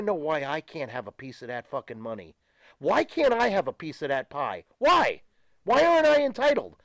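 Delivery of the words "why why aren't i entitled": much higher and louder, indignant why; real frustrated protest rising sharply; exasperated outburst. Real, not acted. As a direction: cry out at the unfairness. Ranting about not being paid.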